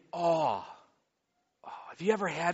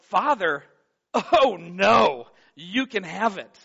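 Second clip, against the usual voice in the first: mock refusal; 3 semitones higher, 9 dB louder, slower than usual. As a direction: wave off the Father, half-hearted. Congregation pushing God away.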